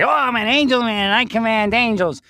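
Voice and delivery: hammed-up self-important angel commander; mock grand angel man. Recounting what he did not say, in a pompous high voice. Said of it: "didn't say".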